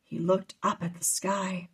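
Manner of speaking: ordinary delivery, nothing marked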